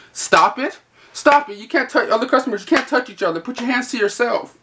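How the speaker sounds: high, prissy telling-off; sarcastic imitation of reprimanding naughty customers; mock scolding. Clerk mimics policing customers who touch each other, mock scolding.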